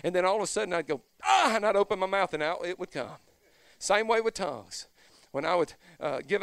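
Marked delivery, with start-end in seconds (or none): 1.2-1.5 mock gasp of release. Sudden release in story.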